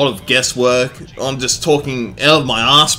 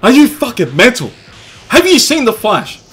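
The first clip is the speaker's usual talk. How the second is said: much higher, loud disbelieving yell; incredulous outburst; 10 semitones higher, 8 dB louder than usual. Acted. Commentator scoffing at the enemy's chances, loud incredulous yell. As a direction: scoff loudly at the very idea. Mocking anyone who'd outrun him.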